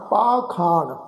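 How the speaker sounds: ਪਾਖਾਣ with two long aa sounds; teacher models ਪਾਖਾਣ; pronunciation model. Shown as a demonstration. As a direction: say ਪਾਖਾਣ, holding both kannas. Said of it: "ਪੱਪੇ ਨੂੰ ਕੰਨਾ, ਖੱਖੇ ਨੂੰ ਕੰਨਾ".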